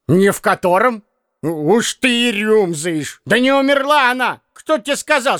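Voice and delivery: put-on a muddled old man covering a slip; flustered old man. A narrator's reading. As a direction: tangle yourself up in excuses. Piskarev blustering, tangled.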